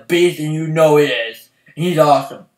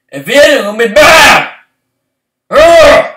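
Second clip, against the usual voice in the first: angry; 7 semitones higher, 8 dB louder than usual. Real, not acted. Threatening to be mad.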